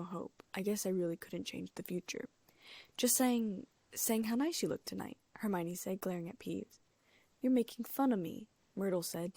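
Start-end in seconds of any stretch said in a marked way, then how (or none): 3.0-5.2 flustered. A narrator's reading. Hermione covering up nervously. 7.4-8.5 tearful. A narrator's reading. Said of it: "tears welling up in her eyes".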